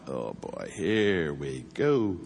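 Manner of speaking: put-on low, slow, resigned oh boy; a wary cop's here we go; wary dread. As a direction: brace for trouble, slow. Cops sensing trouble ahead.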